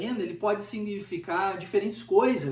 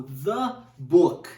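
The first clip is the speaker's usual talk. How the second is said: "the book" with THE set apart and louder on its own beat; emphatic THE; 4 semitones lower, 4 dB louder than usual. Shown as a demonstration. Teacher pointing to one specific book, stress on "the". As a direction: separate "the" from "book" to single it out. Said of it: "é o livro específico".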